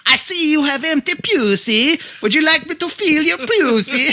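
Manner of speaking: high, loud cartoon Viking voice; mock Viking. Comedian's Viking strides in to his girlfriend, absurd high Viking voice. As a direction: bellow it high like a hammed-up Viking. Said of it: "that is not the voice she was thinking about".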